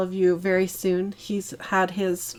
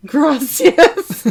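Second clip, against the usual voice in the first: high, loud mock Spanish accent; bad Spanish accent; 7 semitones higher, 11 dB louder than usual. Mocking own thanks to a donor in an exaggerated bad Spanish accent. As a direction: ham up a terrible Spanish accent. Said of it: "Now that's a bad accent".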